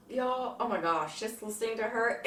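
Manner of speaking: ordinary talk, nothing marked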